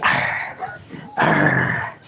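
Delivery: low playful growl; mock growl. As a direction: growl at the dog. Growl at dog.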